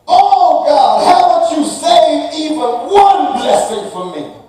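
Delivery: desperate exclamation. Acted. Esau crying out against his cheating brother, loud and desperate. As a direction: shout the betrayal to heaven. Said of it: "Esau exclaimed".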